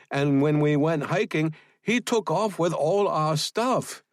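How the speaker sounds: chatty cover story, pitched high; innocent tourist woman. A narrator's reading. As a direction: play the apologetic, harmless tourist. Voices Fong's innocent tourist story.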